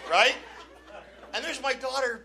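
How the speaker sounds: ordinary delivery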